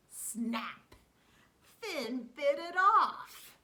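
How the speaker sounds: loud, slow, dramatic reveal; excited. A narrator's reading. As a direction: land the climax loudly. Climax of story, exclaimed.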